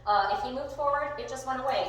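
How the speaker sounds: usual speaking voice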